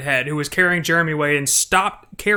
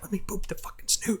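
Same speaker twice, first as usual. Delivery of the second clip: whispered, much softer plea; pleading whisper; 4 semitones higher, 11 dB quieter than usual. Retold or imitated. Player retelling pleading with the pig, whispered. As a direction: beg under your breath. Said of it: "I was like".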